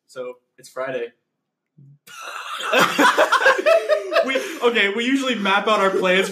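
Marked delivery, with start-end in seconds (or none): 2.2-4.2 big high laugh. Real, not acted. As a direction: burst into a high, hearty laugh. Bursts out laughing at the plans.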